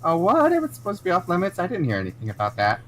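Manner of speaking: feigned surprise. Acted. Player voicing tomboy lying.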